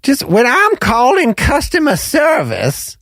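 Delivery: Flo voice. Acted. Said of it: "people don't wanna hear that voice".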